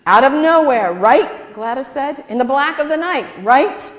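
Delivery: loud, high insisting; eager kid. A narrator's reading. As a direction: push it eagerly like a kid sure she's right.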